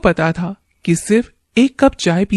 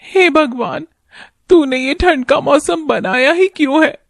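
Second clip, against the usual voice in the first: put-on higher, worried young wife; wife's voice; 7 semitones higher than usual. Wife worried about husband's early work, higher wife voice. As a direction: speak as a caring wife fretting over her husband.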